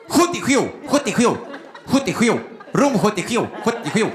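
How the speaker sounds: repeated number in thick Gotland dialect; mangled Gotland accent. Retold or imitated. Receptionist repeats room number, garbled Gotland accent. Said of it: "Har du fått en stroke?".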